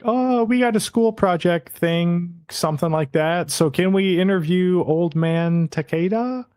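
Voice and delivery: high, eager made-up excuse; innocent boy voice. Retold or imitated. Yoshiki talking to Takeda's wife, high and innocent. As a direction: chirp it out like a kid fibbing. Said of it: "and Yoshiki is like".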